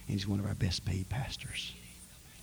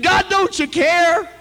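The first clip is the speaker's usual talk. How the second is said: shouted plea; 14 dB louder than usual. Retold or imitated. Repeated question, now shouted.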